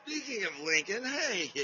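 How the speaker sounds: high dog greeting. Real, not acted. Greeting his dog on camera.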